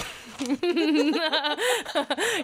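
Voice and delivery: high, rising burst of laughter; amused laugh. Real, not acted. Laughing with cohost over the wrong notebook page, amused. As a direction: crack up at the slip.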